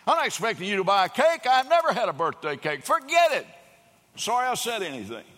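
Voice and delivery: high, loud, fast angry reply; retold angry outburst; equal anger. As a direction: fire it back hurt and angry. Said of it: "responds back in equal anger".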